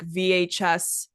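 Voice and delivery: ordinary talk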